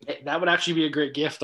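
ordinary delivery

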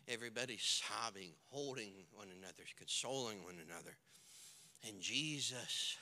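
grave, quiet pulpit storytelling; sombre preaching. A narrator's reading. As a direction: let the grief sink in, very softly. Hushed grief narration in sermon.

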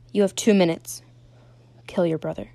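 loud, commanding controller; imposing villain's command. A narrator's reading. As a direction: deliver a forceful order, then drop the weight. Unseen voice gives a deadly order.

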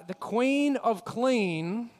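drawn-out mock title; slow ironic emphasis. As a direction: stretch it out with irony.

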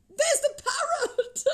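high, loud, drawn-out shout of delight; excited cry. A narrator's reading. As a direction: cry out with a laughing thrill. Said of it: "cried Scrooge".

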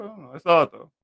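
normal talking voice

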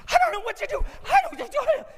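high, loud imitation of a panicking passenger; panicked freak-out. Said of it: "she was freaking out".

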